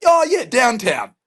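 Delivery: high, loud, drawn-out "Oh, yeah, downtown"; mock recognition. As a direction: exaggerate sudden understanding, slowly and high. Mock recognition of the vague answer.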